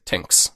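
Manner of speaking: "tinks" with a plain t and no h sound, Irish style. Retold or imitated. Host imitates how the Irish say thanks, t without h. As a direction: say "tinks", hard t, no th. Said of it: "No, no H sound".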